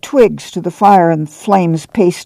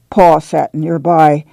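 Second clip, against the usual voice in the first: ordinary delivery, nothing marked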